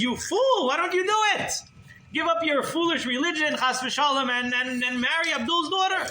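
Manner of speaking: high, loud berating; retold yelling. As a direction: yell at him like he's an idiot. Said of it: "Ahmed starts yelling at me".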